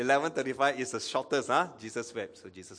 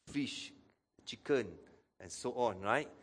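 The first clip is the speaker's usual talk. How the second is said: ordinary delivery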